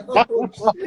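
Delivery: high, loud burst through laughter; Oh, shit laughed out loud; laughing. Real, not acted. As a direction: crack up mid-line. Laughing at partner's answer.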